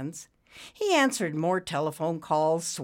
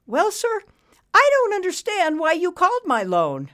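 very high, loud protest; pleading borrower; 9 dB louder than usual. A narrator's reading. Borrower asking banker about his loan, pleading. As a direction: appeal to him, pitched way up. Frank's character voice.

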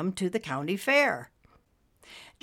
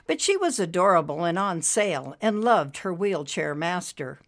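usual speaking voice

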